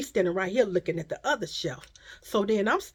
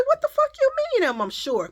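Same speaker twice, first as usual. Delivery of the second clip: high, loud indignant comeback; retold mad retort; 5 dB louder, faster than usual. Mother answering a doubting stranger, retold as mad. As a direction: fire back in outraged disbelief, pitched way up. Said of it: "I'm mad".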